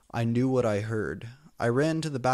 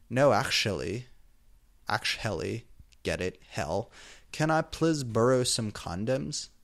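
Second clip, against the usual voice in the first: ordinary delivery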